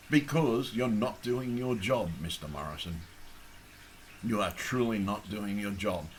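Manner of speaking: ordinary talk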